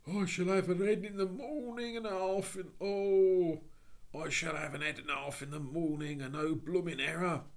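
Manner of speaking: rough cockney plea; cockney burglar. Acted. Burglar voiced in story.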